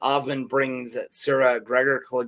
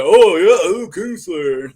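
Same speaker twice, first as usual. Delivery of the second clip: louder, slow sarcastic drawl; hammed-up scoffing guard; mock disbelief; 4 semitones higher, 9 dB louder, slower than usual. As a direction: brush him off, dripping with doubt. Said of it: "He's like".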